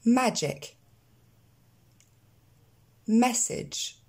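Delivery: "Magic", "Message", "Event" with relaxed è-like short vowels; spaced words, "Busy" as bezzy, no French ee; pronunciation drill. Shown as a demonstration. Teacher chaining all words for repetition, pronunciation drill. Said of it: "on les enchaîne tous".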